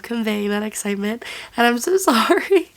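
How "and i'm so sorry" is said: a laugh breaking into sorry, pitched up; laughing apology. Real, not acted. Vlogger apologizing to viewers for her voice, laughing. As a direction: giggle through the apology.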